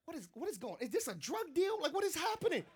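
softly freaked-out mock alarm; mock suspicion. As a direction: act out a furtive, baffled questioning. Acting bewilderment at friend's secretive move.

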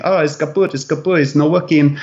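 a lightly done, bad Czech accent for the taxi driver; hint of a Czech accent, pleading the car is broken. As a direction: play a Prague cabbie in a clumsy Czech accent. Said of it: "really bad accent there".

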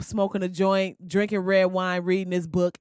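normal talking voice

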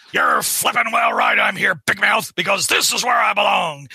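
blustering comic-book boss shout; J. Jonah Jameson. Acted. Jonah answers the protester who announced him, hammed-up shouted bluster. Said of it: "That's a good Jameson".

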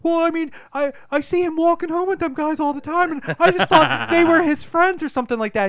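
put-on very high, loud little-boy whine; hammed-up panicky kid voice; mock crying kid. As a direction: blurt out excuses like a terrified child. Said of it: "the kid just starts crying".

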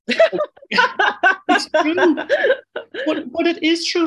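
laughing loud and high through the words. Real, not acted. Guest laughing as he insists women are stronger. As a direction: break into laughter while insisting.

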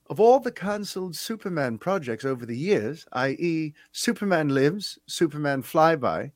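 a light imitation of a British accent; a hint of a Brit reading a question. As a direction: read the viewer's question in a mild British accent. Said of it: "I will attempt to do his accent".

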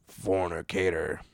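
"Fornicator." low, slow and solemn, like a stern preacher's verdict; mock grave intoning. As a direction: pronounce it like a biblical condemnation.

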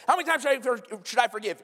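high, pushy repeat question; mock shopping around for an answer; eager advice seeker. Asking the next person the same.

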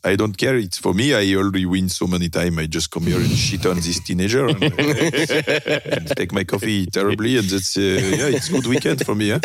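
put-on cocky French-accented driver. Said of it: "I need to work on my French accent".

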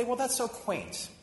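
slow, drawn-out mock condescension; mock sneer, slowly drawn out; condescending. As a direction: drip with patronizing sarcasm. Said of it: "And I'd say".

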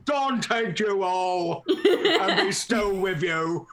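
slow, hammed-up troll voice; comic troll impression, drawn out; William. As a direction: drawl it out as an imitated troll. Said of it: "so imagining William's like".

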